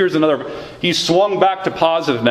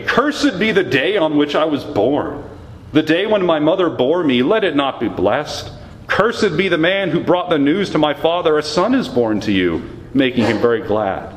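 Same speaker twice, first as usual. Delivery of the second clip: higher, impassioned reading of the lament; lamenting scripture reading; 5 semitones higher than usual. Acted. Pastor reads Jeremiah's private lament to the Lord, impassioned. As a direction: pour out the complaint as you read. Reading the curse on his birthday.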